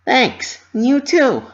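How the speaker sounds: high, loud cheery thanks; cheered. A narrator's reading. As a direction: cheer it out while waving. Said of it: "Pinky cheered".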